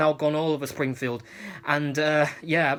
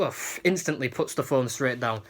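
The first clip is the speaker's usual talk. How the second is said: normal talking voice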